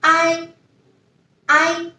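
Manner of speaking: ai said high and level; final ai. Shown as a demonstration. Teacher modelling the final ai. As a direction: glide a to i on a high tone. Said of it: "pronunciation is".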